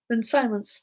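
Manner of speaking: ordinary delivery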